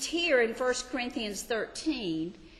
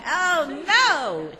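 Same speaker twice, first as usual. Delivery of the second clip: loud, high "oh no"; high-pitched comic alarm; mock dismay; 7 semitones higher, 8 dB louder than usual. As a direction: exclaim in hammed-up dismay. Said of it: "I'm like".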